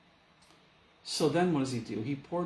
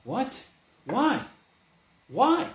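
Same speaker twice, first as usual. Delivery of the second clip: higher, louder, rising disbelief; each why climbs higher and louder; Peter's shocked protest; 8 semitones higher, 5 dB louder than usual. Retold or imitated. Peter reacts to Jesus' ultimatum.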